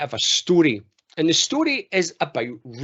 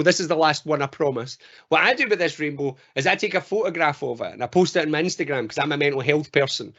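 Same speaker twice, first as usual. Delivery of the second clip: ordinary delivery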